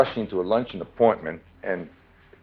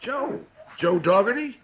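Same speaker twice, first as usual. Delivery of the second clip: mock recognition; 12 semitones higher than usual. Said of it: "I said".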